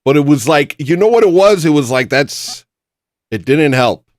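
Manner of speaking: agitated loud venting; worked-up rant. Real, not acted. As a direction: let frustration boil over.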